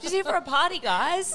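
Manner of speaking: high mock-innocent voice. Imagined barman's excuse.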